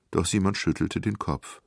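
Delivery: usual speaking voice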